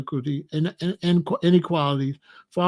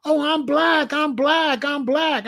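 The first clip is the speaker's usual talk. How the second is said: mocking high voice; 7 semitones higher, slower than usual. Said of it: "came up to me and said".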